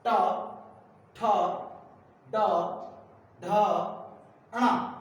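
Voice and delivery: ट ठ ड ढ ण each alone, tongue curled back; retroflex consonants. Shown as a demonstration. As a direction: sound out ट, ठ, ड, ढ, ण loudly with the tongue bent backwards. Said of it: "ये मूर्धा से बोले जाते हैं, जीभ मुड़ जाती है".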